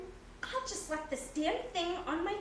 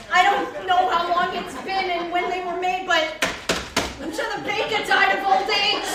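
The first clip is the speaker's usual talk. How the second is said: loud joke; 3 semitones higher, 10 dB louder than usual. Acted. Joke mocking stale croissants.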